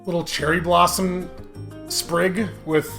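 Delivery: usual speaking voice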